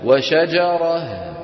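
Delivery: slow وشجرة ending in h; teacher shows the stop on وشجرة; pausal form. Shown as a demonstration. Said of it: "كيف نقف عليها".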